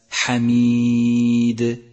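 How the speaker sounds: very slow, loud chanted حميد, long ي, bounced final د; qalqalah. Shown as a demonstration. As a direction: stretch حميد then release د with an echoing bounce. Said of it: "صفة القلقلة". (ترتيل حميد بقلقلة كبرى في الدال)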